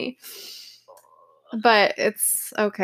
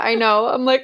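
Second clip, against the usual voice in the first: ordinary talk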